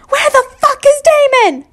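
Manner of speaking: loud furious demand for Damon. Acted. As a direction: yell it, hostile. Enemy-controlled Harry demanding.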